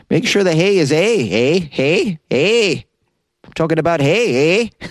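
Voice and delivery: high, lilting mock Canadian accent; exaggerated singsong Canadian accent. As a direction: play up the Canadian "eh" with rising lilt. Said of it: "this Canadian accent".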